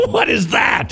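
high laughing squeal; laughing shout. Acted. Mocking a dream, laughing.

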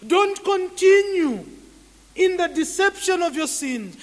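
loud, high-pitched pulpit exhortation; full-force preaching. Real, not acted. Preacher urging the congregation to turn from sin, shouted exhortation. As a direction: thunder the rebuke, each phrase driven home.

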